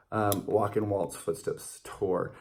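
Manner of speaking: ordinary talk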